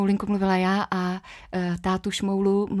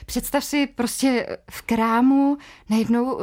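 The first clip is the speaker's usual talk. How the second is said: usual speaking voice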